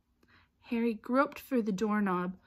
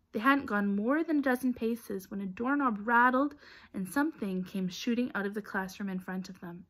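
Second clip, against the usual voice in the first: ordinary delivery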